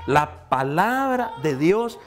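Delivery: high-pitched, emphatic sermon delivery; fervent preaching. Real, not acted. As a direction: preach it out, stretching the key words. Preaches at full force. (prédica enérgica y aguda)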